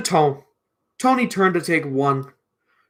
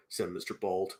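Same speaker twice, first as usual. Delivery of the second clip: plain reading voice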